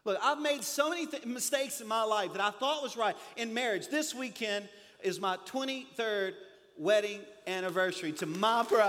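ordinary delivery

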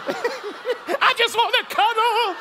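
very high, slow cooing; husband pleading to cuddle; mock sweet plea. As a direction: coo it high and drawn out. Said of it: "I'm like".

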